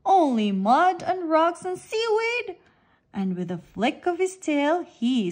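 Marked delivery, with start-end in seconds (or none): 0.1-2.6 grumbling. A narrator's reading. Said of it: "grumbles Shark".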